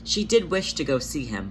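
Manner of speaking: usual speaking voice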